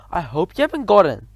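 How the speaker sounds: usual speaking voice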